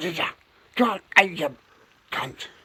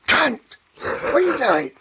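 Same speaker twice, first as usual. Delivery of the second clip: a louder, higher confronting shout; raised street challenge; retold shout; 7 semitones higher, 4 dB louder than usual. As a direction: bark it at a stranger to challenge him. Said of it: "And I went".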